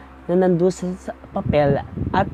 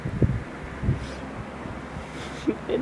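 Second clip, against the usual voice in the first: breathy giggle, barely voiced; soft embarrassed laugh; 8 dB quieter than usual. Real, not acted. Vlogger laughing at her own story, hushed giggle. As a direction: laugh off the awkward moment quietly.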